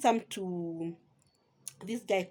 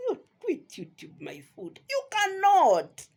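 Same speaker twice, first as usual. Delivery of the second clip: loud, high, drawn-out dismissal; emphatic scoffing; 8 semitones higher, 4 dB louder, slower than usual. Real, not acted. Vlogger daring another to quit YouTube, emphatic scoffing. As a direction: stretch it out as a loud challenge. Scolding challenge to a quitting vlogger.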